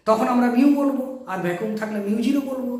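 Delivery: ordinary talk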